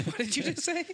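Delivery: high startled disbelief; mock shock. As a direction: act scandalized at the remark. Mock outrage at game beers.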